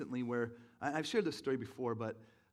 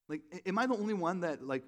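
usual speaking voice

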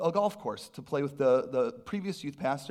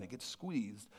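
soft; 7 semitones lower, 13 dB quieter, slower than usual. Acted. Repeated refrain, softer.

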